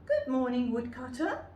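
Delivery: put-on girl calling to woodcutter; little girl calling out. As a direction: call out brightly to the woodcutter.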